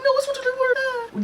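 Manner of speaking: squealed, very high mock pleading; sky-high silly pleading voice; high mock protest. Acted protest about password.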